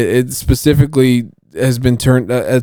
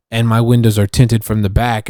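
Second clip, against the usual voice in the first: ordinary delivery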